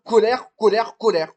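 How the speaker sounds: loud reproachful rant; angry scolding. Acted. Acted quarrel, scolding partner.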